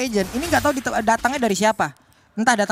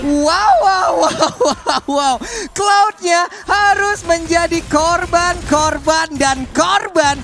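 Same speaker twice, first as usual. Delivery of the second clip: excited; 3 semitones higher, 3 dB louder, much slower than usual. Real, not acted. Caster reacts to kills.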